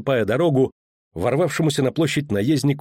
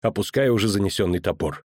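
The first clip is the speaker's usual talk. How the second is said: usual speaking voice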